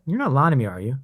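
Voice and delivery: imitating a flirty interrogation; flirtatious chiropractor. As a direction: playfully accuse her, coyly. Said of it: "And he'll go".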